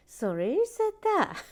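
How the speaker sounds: very high, loud indignant question; hammed-up affronted squeal; mock outrage. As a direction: demand it in mock shock. Repeats louder in outrage.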